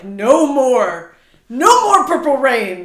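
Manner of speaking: put-on loud, slow proclamation of a boycott; mock declaration. Retelling her reaction to Prince, a loud mock vow. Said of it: "I was like".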